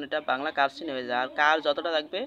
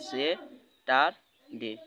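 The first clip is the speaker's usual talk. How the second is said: সে, টার, ডে with a clear pause after each syllable; slow, evenly spaced syllables like a teacher at the board; syllables split apart; slower than usual. Shown as a demonstration. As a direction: break সে টার ডে into its three beats. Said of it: "আপনাকে যদি বলা হয় যে এই Saturday".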